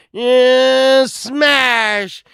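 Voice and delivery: high, drawn-out retold cry; mock battle cry. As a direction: stretch it into the crash.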